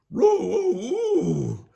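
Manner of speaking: loud, high dog howl; Scooby howl. Acted. Scooby wailing in trouble, loud high howl. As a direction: howl out in cartoon alarm. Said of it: "Scooby cried".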